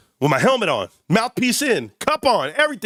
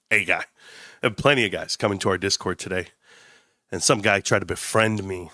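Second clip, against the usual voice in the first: ordinary talk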